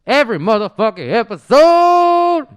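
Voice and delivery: loud, high announcer plug. Acted. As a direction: sell it like a radio host. Plugging the channel.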